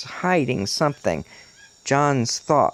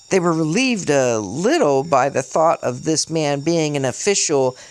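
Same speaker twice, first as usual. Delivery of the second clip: ordinary delivery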